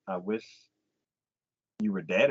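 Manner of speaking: lightly voiced bitter husband; mock low husband. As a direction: drop low and grim.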